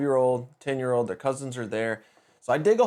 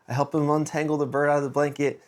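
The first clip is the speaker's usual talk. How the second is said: ordinary delivery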